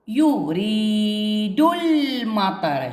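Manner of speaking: أريد المطر drawn out slowly, ال not sounded; very slow reading. Shown as a demonstration. Teacher shows silent hamzat al-wasl to pupils, very slow. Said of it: "صارت هنا وصلت بين".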